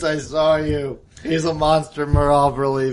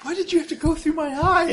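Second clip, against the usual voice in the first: shocked high whine; 14 semitones higher than usual. Acted. Stabbed in eye.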